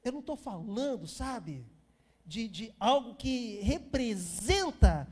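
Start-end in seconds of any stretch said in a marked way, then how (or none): none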